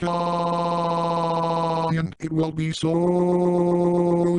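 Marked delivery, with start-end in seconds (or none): 0.0-1.9 long, monotone held "ah"; drawn-out imitation scream at ordinary volume; mock scream. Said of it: "he will scream like". 2.7-4.4 "so" stretched out very long; slow, elongated mock dread; drawn-out emphasis.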